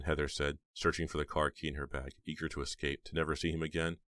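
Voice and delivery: ordinary delivery, nothing marked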